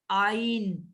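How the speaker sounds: آئین said as "aa-een", long open a before the ee; teacher models آئین slowly, starting with a clear alif sound; correct letter name. Shown as a demonstration. Teacher correcting a student's letter name, careful model. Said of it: "آئین کہنے ہیں".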